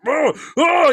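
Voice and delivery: loud, high imitated roar of frustration; two big mock-furious groans; enraged groan. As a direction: mimic a man too mad for words. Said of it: "he got so mad".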